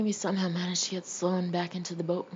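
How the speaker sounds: usual speaking voice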